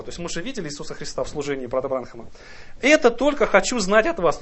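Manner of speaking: normal talking voice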